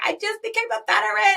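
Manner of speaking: high, loud mock squeal of news; excited news. Teammate texting her friend big news, excited. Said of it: "She texted me".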